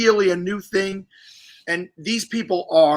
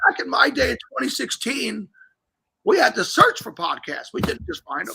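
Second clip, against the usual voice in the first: put-on higher, creaky old-timer complaining; old man voice; 7 semitones higher than usual. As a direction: play a grumpy grandpa scolding kids. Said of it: "sound like an old man and say".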